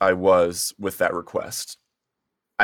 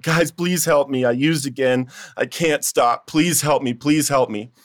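re-enacted plea in a raised pitch; desperate pleading; 5 semitones higher than usual. Man re-enacting begging his Bible study friends, desperate pleading. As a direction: beg for help, higher than usual talk. Bursting into prayer meeting begging help.

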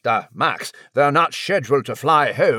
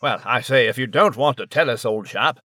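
put-on British accent; 4 semitones lower than usual. British character Nigel.

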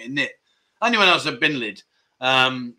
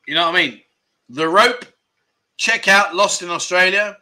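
ordinary talk

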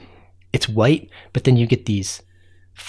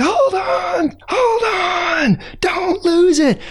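high anxious call, hold on hold on; much slower than usual. Acted. Narrator calling to the ants on the sled, high cry. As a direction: shout encouragement up high to the sled.

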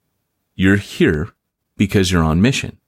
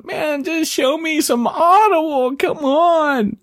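much higher, slow, whiny mock heckle; Peter heckling; slower than usual. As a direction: whine for the Audible books, hammed up. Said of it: "I'm picturing Peter go".

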